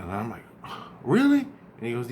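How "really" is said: high, incredulous rising really; a retold jolt of surprise; surprised really. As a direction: shoot the pitch way up in disbelief. Told dream secret, he reacts.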